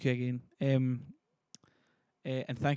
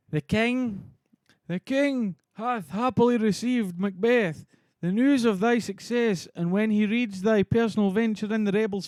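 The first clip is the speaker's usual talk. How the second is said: loud, higher, slow declamation; Ross, grand herald; 7 semitones higher, 9 dB louder, slower than usual. Acted. Streamer as Ross bringing news from the king, grandly declaimed. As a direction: proclaim the news with stretched, ringing words.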